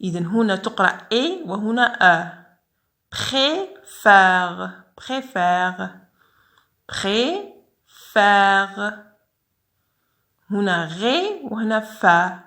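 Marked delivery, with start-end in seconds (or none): 3.1-8.9 Préfère said three times, closed é then open è; repeated demo. Shown as a demonstration. Teacher drills préfère to show both accents. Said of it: "هنا accent aigu وهنا accent grave".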